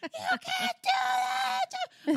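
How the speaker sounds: very high, drawn-out mock wail; hammed-up squealing protest; imitated child meltdown. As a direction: wail it like an outraged little kid. Imitating son's meltdown at earlier bedtime.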